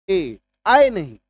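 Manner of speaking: ए said slowly, not आए; careful vowel demo; soft e sound. Shown as a demonstration. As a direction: contrast ए against आए, slowly. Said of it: "जब भी आप बोलेंगे ए".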